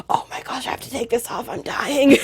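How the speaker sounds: hushed, gasped plea rising in pitch; mock desperation. Overdressed visitor overheating indoors, mock desperate. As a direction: play suffocating, starting in a whisper. Acts overheating in winter layers.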